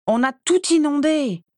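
high-pitched outcry; exclaiming. A narrator's reading. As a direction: cry out, voice jumping up. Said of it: "s'exclama Andromeda". (exclamation aiguë)

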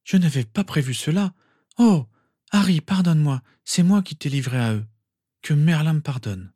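high-pitched anguished plea; distraught, near sobbing. A narrator's reading. Mother begs Harry's forgiveness, distraught. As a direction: plead for forgiveness on the edge of tears. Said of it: "Elle éclata en sanglots".

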